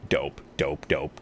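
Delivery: normal talking voice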